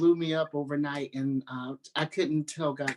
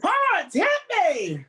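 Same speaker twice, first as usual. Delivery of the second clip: much higher, louder hype call; excited catchphrase; 7 dB louder, slower than usual. Real, not acted. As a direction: fire up the audience with the sign-off. Signature sign-off catchphrase.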